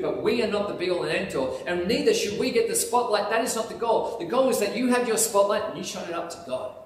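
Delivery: ordinary delivery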